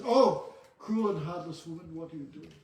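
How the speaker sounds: loud cry of "Oh", then easing off; mock outraged reproach. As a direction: act out a shocked husband's rebuke, trailing down in volume. King reproaches wife drowning children.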